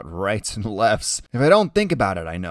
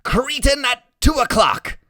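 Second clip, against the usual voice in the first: high, loud warning; urgent boy calling out danger; real urgent; 14 semitones higher, 8 dB louder than usual. A narrator's reading. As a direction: sound the alarm like a lookout, stretching the words. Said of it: "real urgent".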